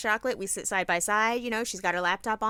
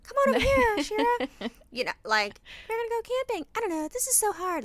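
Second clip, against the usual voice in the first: high, sing-song make-believe voice; high, hammed-up playtime character voice; pretend play voice; 11 semitones higher than usual. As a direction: play pretend with a little girl. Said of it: "I'm making backstories".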